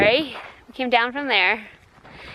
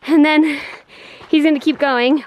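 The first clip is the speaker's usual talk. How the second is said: normal talking voice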